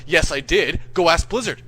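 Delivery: loud defiant comeback; insistent retort. Retold or imitated. Jimmy tells him to ask Blizzard, loud insistence. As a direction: insist loudly, acting out the quarrel.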